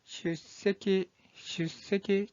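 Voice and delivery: 슈세키 slowly, with a held stop before 세; pronunciation demo. Shown as a demonstration. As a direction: model 슈세키 in slow, separated syllables. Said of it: "이 한자의 발음은".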